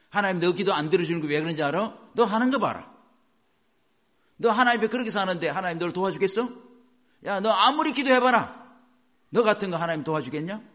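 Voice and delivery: loud, scornful taunting; preacher acting out a jeering accuser; accusing attacker. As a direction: needle them with contemptuous questions. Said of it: "이렇게 해요".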